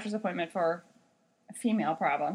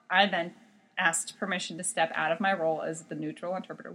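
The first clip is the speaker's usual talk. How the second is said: ordinary talk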